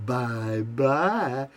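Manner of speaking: a soft, belittling singsong bye-bye from the wife; wife's condescending farewell to her husband; mocking bye-bye. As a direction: sweetly needle him as he leaves. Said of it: "She'd go".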